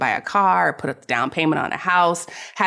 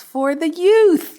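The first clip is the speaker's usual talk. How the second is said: very high, slow and drawn out; sing-song high; 13 semitones higher, 4 dB louder, much slower than usual. Pretend. Podcast host playfully sings the topic, high and drawn out. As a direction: stretch it up in a silly high voice. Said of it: "that last one was a little outside of your range".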